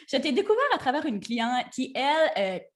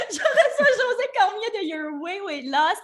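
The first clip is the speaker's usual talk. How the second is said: laughing; 8 semitones higher, 4 dB louder than usual. Real, not acted. Host introducing guest while laughing.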